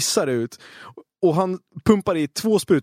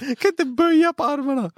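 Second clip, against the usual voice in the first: laughing; 13 semitones higher than usual. Pretend. Acting out stiff arms.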